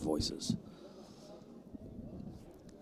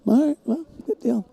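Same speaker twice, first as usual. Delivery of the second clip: very high-pitched, put-on surprise; mock acceptance; 7 dB louder than usual. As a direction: play back a baffled, agreeable reaction in a squeaky-high voice. Acting own reaction to viewer's claim.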